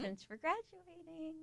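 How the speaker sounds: high, drawn-out sing-out; mock happy. Real, not acted. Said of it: "You sound so happy".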